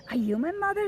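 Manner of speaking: high, small questioning chick; baby bird voice. Acted. Baby bird asking the kitten, high and hopeful. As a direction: ask it up high like a little bird.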